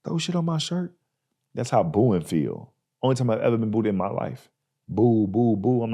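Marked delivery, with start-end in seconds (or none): 0.0-1.0 high, soft stunned disbelief; shocked disbelief. Retold or imitated. Kid at lunch finding food on his shirt, stunned. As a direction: gasp it in disbelief. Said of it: "you look at your shirt like".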